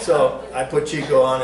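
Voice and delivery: ordinary delivery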